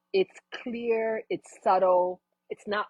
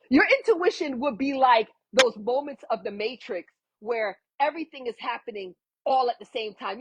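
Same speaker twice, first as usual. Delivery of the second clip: ordinary talk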